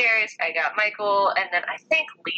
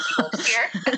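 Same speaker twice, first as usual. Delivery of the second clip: laughing retort; 4 semitones higher, slower than usual. Retold or imitated. Laughing while retelling her defence.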